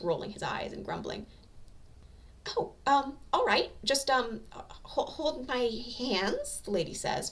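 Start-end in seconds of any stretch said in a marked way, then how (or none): 2.6-6.6 higher, hesitant, slow flustered voice; shy flustered woman. A narrator's reading. Shy woman asking Katsuki to hold hands, flustered. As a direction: ask it shyly, blushing. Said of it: "face turning bright pink".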